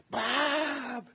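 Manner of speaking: high, drawn-out call of recognition; retold joyful scream. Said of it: "she screams out".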